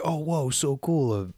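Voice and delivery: mock kid excitement. Kids thrilled by gift fish.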